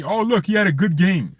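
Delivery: whiny put-on fan argument; mock insistence. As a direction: imitate a pleading fan, pitched well up. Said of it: "People wanna convince me".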